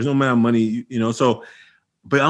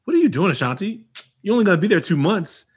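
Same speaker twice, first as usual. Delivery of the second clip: high, questioning inner voice; 7 semitones higher than usual. Retold or imitated. Speaker's inner voice doubting tutoring, high questioning. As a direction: ask yourself like a doubtful friend. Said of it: "And I remember, like".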